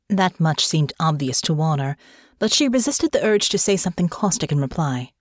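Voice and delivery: ordinary delivery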